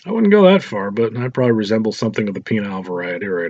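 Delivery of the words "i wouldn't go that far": joking protest. Acted. Michael's raised, joking rebuttal.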